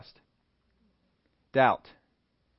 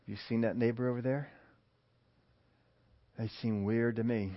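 gossiping; 4 semitones lower, 8 dB quieter than usual. Acted. Gossip about the neighbor.

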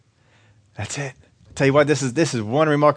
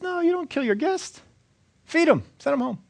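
put-on high, soft, amused old prophet; gentle high Elisha voice; 3 dB quieter than usual. Elisha tells the king to spare his enemies, high and soft. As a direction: lift the voice high and soft, kindly waving it off.

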